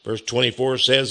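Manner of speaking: usual speaking voice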